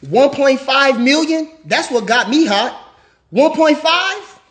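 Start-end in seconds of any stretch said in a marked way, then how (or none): 3.3-4.4 incredulous disbelief. Real, not acted. Incredulous at Kell Brook's purse.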